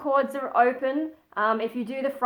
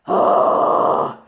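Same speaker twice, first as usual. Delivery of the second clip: loud airy distorted sigh; breathy distortion sigh; 8 dB louder than usual. Shown as a demonstration. Practising breath distortion for viewers, airy sigh. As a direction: push the breath into a rough sigh. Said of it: "keep playing with that".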